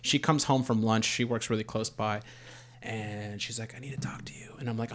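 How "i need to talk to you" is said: hushed, whispered interruption; imitated whisper. Partner interrupts him on a sales call, imitated whisper. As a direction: whisper it as if someone nearby is on a call.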